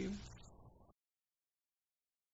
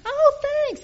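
high imitated woman; 13 dB louder than usual. Woman's reply, retold chat.

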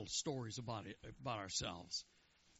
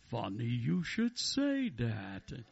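voice from heaven; 7 dB louder, much slower than usual. Acted. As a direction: boom it out slowly, swooping up on the punchline. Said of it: "a voice from heaven says".

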